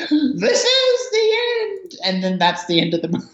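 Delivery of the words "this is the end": very high, slow mock falsetto; drawn-out high-pitched character voice; film character's proclamation. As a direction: squeal it out slowly as a silly closing announcement. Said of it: "She pops through the hole and says".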